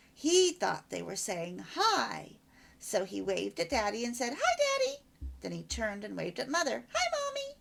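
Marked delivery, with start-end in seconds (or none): 4.4-5.0 put-on much higher small-child voice; a toddler happily greeting daddy; little child waving. 6.9-7.6 put-on much higher small-child voice; a toddler happily greeting daddy; little child waving.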